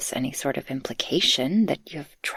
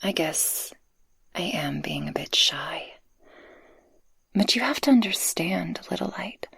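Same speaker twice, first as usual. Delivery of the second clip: shy whisper. Acted. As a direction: admit it gently, close to the ear.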